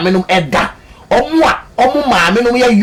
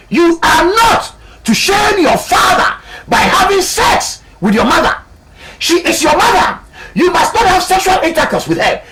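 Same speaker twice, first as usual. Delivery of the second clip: mock biblical prophet; 9 semitones higher than usual. Preacher proclaims God's law again.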